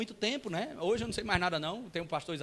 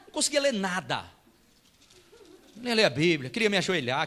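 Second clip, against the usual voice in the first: normal talking voice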